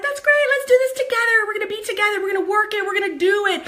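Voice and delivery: pep talk full of excitement; gushing encouragement. Retold or imitated. Cheering on a new partner, high and rallying. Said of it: "And I'm like".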